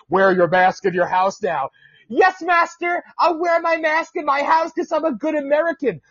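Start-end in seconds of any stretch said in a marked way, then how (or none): 2.1-6.0 higher, servile mock voice; mocking obedient citizen. Podcaster mocking obedient mask wearers, higher and servile. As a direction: simper like an obedient servant.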